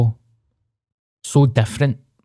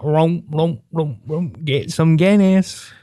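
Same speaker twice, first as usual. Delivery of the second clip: higher, comic rumbling noise, fading out; silly mock grunting; 5 semitones higher, slower than usual. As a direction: play a daft growling voice for a laugh. Jokes his testicles are thirsty.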